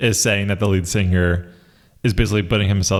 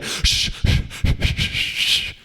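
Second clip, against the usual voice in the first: whispered shh drawn out; long shushing; much slower than usual. Shown as a demonstration. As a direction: shush the room slowly. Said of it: "a really long pronounced shushing".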